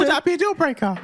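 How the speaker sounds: joking high-pitched voice; put-on high voice. Man teasing his partner about his voice, put-on high pitch. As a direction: pitch it way up, as if it were yours. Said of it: "This is actually my real voice".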